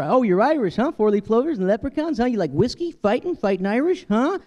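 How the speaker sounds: high eager voice, pitched well up. Retold or imitated. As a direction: rattle off clichés, excited. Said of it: "somebody says".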